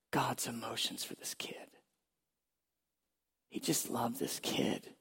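hushed, awed whisper; tender whisper. Real, not acted. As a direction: breathe it out, moved by the love. Moved recalling God's love.

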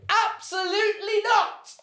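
very high, slow cry of refusal; emphatic refusal. Real, not acted. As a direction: draw it out in a soaring comic no. Emphatic answer to moving question.

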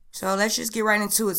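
ordinary delivery

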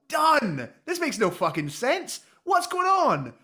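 high-pitched, comic exasperation; exasperated rant. Pretend. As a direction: rant in mock outrage. Mock rant about the plot.

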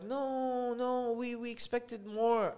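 high, drawn-out pleading; mock a let-down worker's high protest; disappointed protest. Painter protests the lower price.

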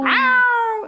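high, drawn-out mew; cat meow. Retold or imitated. Co-host echoing the cat's reply, high long meow. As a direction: stretch out a high imitation meow. Echoes cat answering again.

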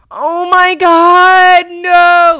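high, loud, drawn-out mock horror; hammed-up horrified yell; horrified outcry. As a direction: wail it out in dramatized mortification. Acting out her reaction to bleeding.

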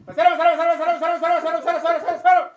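very high, very loud repeated yelling; full yell. Acted. Angry customer yelling for the waiter again. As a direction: scream for the waiter at full force.

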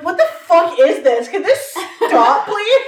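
high, loud, slow laughing complaint; exasperated laughing. Retold or imitated. Retelling hearing the skits, laughing loud and high. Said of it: "I'm like".

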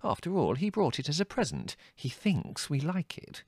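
high, gentle motherly concern; worried. A narrator's reading. Mother excusing Adrian's present to the family, worried. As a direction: plead softly, slowing at the end. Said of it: "said Mother worriedly".

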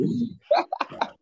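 high, airy laugh; amused laugh. Real, not acted. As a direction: laugh it off lightly. Amused at variants of his name.

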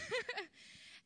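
a quick high laugh. Real, not acted. Preacher laughing after her joking warning, high laugh.

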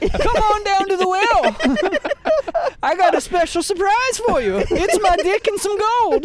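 put-on high, slow old-timer with laughs; old prospector. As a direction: croak like a grizzled gold miner. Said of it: "that's my old prospector voice".

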